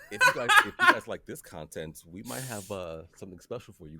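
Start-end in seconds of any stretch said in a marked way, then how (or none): 0.1-2.0 loud laughing plug. Real, not acted. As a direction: laugh through the words.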